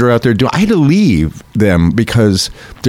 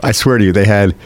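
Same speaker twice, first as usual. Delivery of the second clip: ordinary delivery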